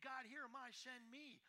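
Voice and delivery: earnest call to God. Acted. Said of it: "who are saying".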